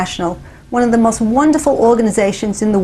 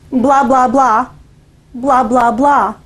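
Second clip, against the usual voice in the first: nervous blah blah; slower than usual. Retold or imitated. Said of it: "so I say".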